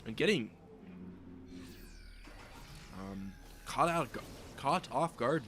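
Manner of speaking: loud, lively esports call of the fight; excited play-by-play. Acted. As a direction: call the battle as it happens, pumped up. Live casting a battle.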